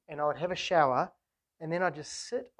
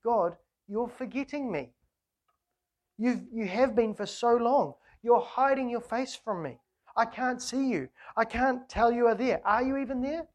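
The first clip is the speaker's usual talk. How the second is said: higher, louder, pleading cry to an absent God; abandoned plea to God; 5 semitones higher, 5 dB louder than usual. Acted. Preacher voicing David pleading with God, anguished. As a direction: voice the psalmist's lonely, anguished appeal. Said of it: "that sense of a feeling of abandonment".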